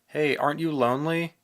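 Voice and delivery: loud, high call across distance. A narrator's reading. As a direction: call out to him. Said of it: "Misaki called out".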